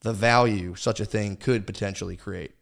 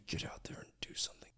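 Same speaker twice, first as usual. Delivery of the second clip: urgent whisper to himself; whispered urging; 19 dB quieter, faster than usual. Retold or imitated. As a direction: hiss the push under your breath. Self pep talk, whispered.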